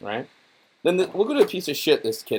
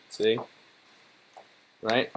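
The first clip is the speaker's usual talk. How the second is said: ordinary talk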